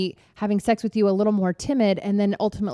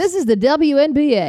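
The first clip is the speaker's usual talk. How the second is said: sportscaster voice; 6 semitones higher, 4 dB louder than usual. Pretend. Joking sportscaster bit.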